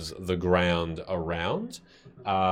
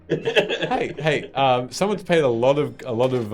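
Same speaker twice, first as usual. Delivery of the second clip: laughing; 5 semitones higher, 5 dB louder than usual. Real, not acted. GM laughing protest.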